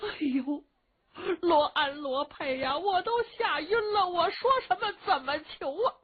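put-on high, tearful old lady; flustered, high-pitched fright; scared witless. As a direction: plead helplessly, close to crying.

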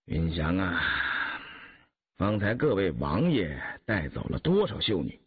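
low-pitched, weary question; sighing. A narrator's reading. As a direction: ask gently with a sigh in the voice. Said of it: "雍正低头喀叹道".

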